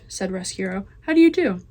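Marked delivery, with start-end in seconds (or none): 1.0-1.6 higher, louder mock-courtly greeting; oily polite rat. Rat greets the serving girl, sly courtly voice. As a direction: greet her like a sly gentleman.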